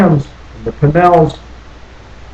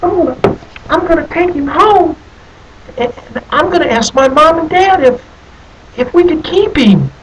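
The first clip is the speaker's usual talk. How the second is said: put-on much higher, eager little boy voice; a small boy bursting to take the bear home; young Johnny; 12 semitones higher than usual. Johnny tells himself he'll keep the bear, high eager child voice. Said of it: "and he said to himself".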